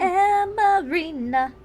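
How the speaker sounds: high, very slow playful intoning; drawn-out echo. Pretend. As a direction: stretch every syllable in a high voice. Echoing the drawn-out name.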